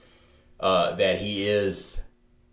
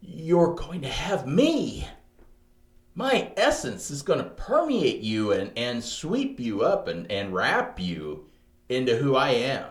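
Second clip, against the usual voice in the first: God; 5 semitones higher than usual. Acted. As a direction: draw it out as a momentous pledge. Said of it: "Or was he like".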